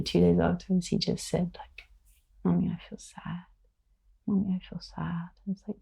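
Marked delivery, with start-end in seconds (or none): 2.4-5.3 put-on soft, whispered little child's lament; quiet imitation of a sad son. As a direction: voice a sad small boy softly. Quoting sad small child.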